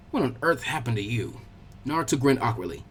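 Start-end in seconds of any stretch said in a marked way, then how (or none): 0.1-1.4 put-on lower, softer old man asking in mild concern; a grandfatherly worry, held back. Hokage sees Naruto's face.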